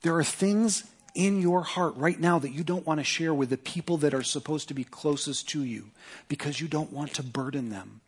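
ordinary talk, nothing marked

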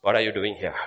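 ordinary talk, nothing marked

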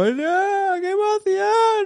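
very high, drawn-out squeal; mock emotional squeal. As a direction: squeal like someone overcome with joy. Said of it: "ella se puso ahí como a llorar".

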